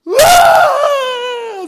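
very loud, high, sustained bawl; hammed-up wailing scream. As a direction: burst out in mock despair. Wife breaks down at counter. (übertriebenes lautes Aufheulen)

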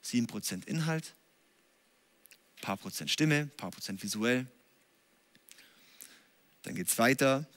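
a monotone sermon that washes right past the listener; dull, lifeless delivery. Shown as a demonstration. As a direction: mumble through it like a preacher who doesn't care. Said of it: "Ich könnte jetzt hier stehen und sagen".

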